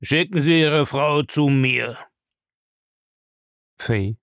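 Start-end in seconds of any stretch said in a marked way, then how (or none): 0.0-2.0 slow, heavy order; slow commanding old man. Acted. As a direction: lay down the order slowly. Bellamy commands Julius.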